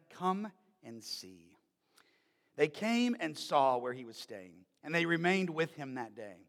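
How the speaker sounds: measured, solemn Bible reading voice; scripture reading. Acted. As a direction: read the Gospel aloud to the congregation. Reads Gospel passage aloud.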